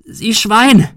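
high, loud roar; furious yell. A narrator's reading. Woman insulting the gunman, furious yell. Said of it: "brüllte sie".

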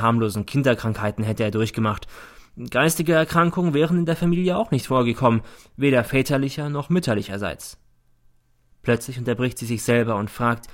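usual speaking voice

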